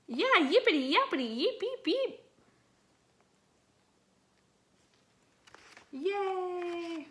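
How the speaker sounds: high, fast yippy puppy voice; squeaky puppy cheer; happy puppy. Acted. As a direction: yip like an overjoyed little dog. Puppy Zippity rejoices.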